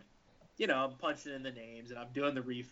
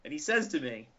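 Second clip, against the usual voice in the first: ordinary delivery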